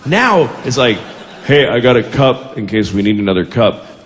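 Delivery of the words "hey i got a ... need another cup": low, casual dim-guy voice; dull guy imitation. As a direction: offer it as a small, low-pitched bright idea.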